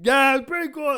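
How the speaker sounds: loud, high goofy reply; mock awkward reply. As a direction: play himself answering awkwardly. Mimicking his own awkward reply.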